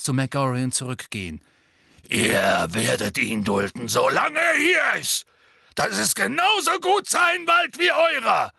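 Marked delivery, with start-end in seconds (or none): 2.1-8.5 starts firm, then a loud high shout. A narrator's reading. Hagrid shouting after the leaving centaurs, much higher and louder. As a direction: yell it back at the centaurs. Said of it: "rief er".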